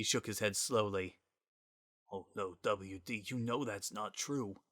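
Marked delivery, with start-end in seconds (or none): 2.1-4.6 1 gentle. Acted. Sans gently contradicts Gaster.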